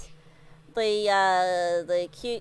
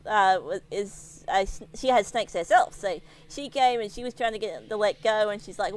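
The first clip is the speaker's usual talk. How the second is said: ordinary talk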